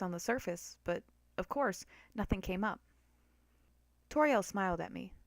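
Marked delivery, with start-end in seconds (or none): none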